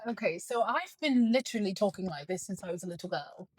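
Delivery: light British accent. Shown as a demonstration. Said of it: "British accent".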